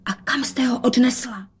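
ordinary delivery, nothing marked

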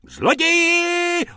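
very high, drawn-out shriek; shrieking woman from the kiosk. Acted. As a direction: scream thief across the street. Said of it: "rozječela se paní".

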